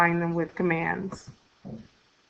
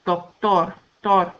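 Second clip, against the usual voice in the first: Doctor, pause, then its stressed "tor"; stress on last syllable; 3 semitones lower, slower than usual. Shown as a demonstration. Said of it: "have the stress on the last syllable".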